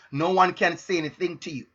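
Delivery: normal talking voice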